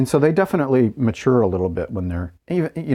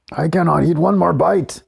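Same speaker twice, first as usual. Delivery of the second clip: Mister Creosote; 8 semitones higher, 3 dB louder than usual. Retold or imitated. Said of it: "Mister Creosote in The Meaning of Life".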